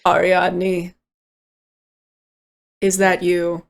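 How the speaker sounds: low, weary hungover voice; hungover groan. Acted. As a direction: speak like a pounding headache. Hungover Marius under washcloth.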